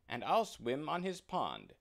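bright, bobbing drake; cheerful drake. Acted. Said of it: "said the drake".

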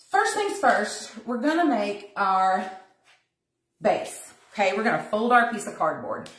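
ordinary delivery

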